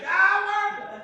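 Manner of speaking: loud high shout thrown back; echoed yell. A narrator's reading. Mountain echo answering the boy, loud shout. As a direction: let the mountain fling it back. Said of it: "he received the answer".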